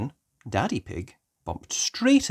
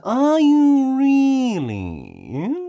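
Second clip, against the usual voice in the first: much higher, very slow sly question; sly wolf; 11 dB louder, much slower than usual. Acted. Mister Wolf hears Peppa's plan, very slow sly voice. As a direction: feign polite interest.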